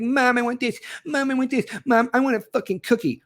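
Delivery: put-on high whining kid demanding a cookie; whiny child. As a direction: whine like a spoiled kid throwing a tantrum. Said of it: "throw temper tantrums like".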